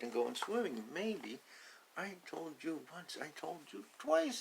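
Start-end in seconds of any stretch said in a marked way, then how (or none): 2.0-4.4 high mock scolding; tease-scold the duckling, high; high playful parent warning; scolding duck. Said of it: "he says".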